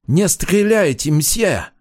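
high-pitched pleading call; crying out. A narrator's reading. Student begging police not to shoot, high cry. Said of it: "выкрикнул он".

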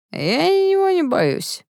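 very high, drawn-out singsong taunt; drunken singsong boast; tipsy bravado. A narrator's reading. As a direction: tease fearlessly in a slow high voice. Drunk Eshton taunts his brother.

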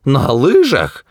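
high, slow exclamation of disbelief; scandalised high outburst; outraged cry. A narrator's reading. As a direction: cry it out, stretching the words. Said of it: "вскричал старец".

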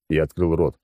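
ordinary delivery

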